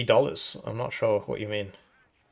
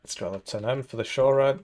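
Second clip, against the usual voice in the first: hiccuping. Real, not acted. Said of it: "Sorry, I keep hiccuping".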